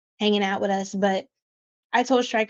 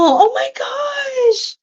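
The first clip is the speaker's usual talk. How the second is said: much higher and slow, stretched delight; drawn-out delighted squeal; 5 dB louder, much slower than usual. Real, not acted. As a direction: let it rise and stretch out in delight. Delighted by a chat comment.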